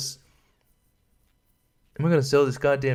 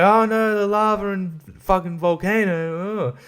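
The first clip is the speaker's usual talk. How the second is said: 1 mock calm girl; 6 semitones higher, slower than usual. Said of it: "she's just like".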